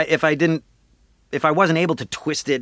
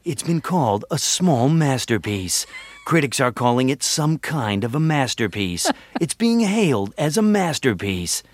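trailer announcer. Acted. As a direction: sell MASTERPIECE like a movie announcer. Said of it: "reference the word masterpiece in illimitable ways".

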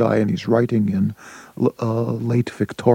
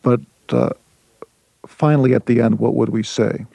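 improvised sonnet. Shown as a demonstration. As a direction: speak each line as a sonnet in the making. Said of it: "here I'm on my way to, to a sonnet".

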